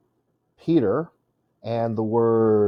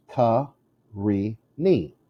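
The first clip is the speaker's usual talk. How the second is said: very slow "Ka-re-ni", a pause after each syllable; "Ka-re-ni" spelled out slowly by sound; much slower than usual. Shown as a demonstration. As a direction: split "Ka-re-ni" into three separate beats. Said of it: "it's three syllables".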